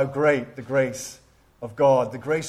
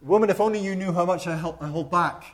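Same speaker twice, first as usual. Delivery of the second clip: a lightly voiced Spurgeon, higher and quick; Spurgeon's retort; 4 semitones higher, faster than usual. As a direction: toss back a witty retort to the lady. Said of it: "he said".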